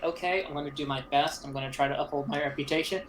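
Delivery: rushed earnest. Retold or imitated. Quoting his own work attitude.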